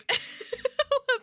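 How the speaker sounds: bright embarrassed giggle; high giggle. Real, not acted. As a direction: laugh it off shyly. Embarrassed laugh after thanks.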